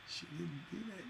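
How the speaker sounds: dropped almost to a whisper; hushed repeat. Shown as a demonstration. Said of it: "But she didn't do that".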